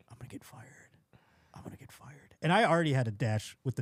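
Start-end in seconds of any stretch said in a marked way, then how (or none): 0.1-2.4 hushed, acted-out doom mantra; barely audible self-muttering; whispered dread. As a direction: whisper it to yourself, hopelessly. Alone backstage, dreading being fired.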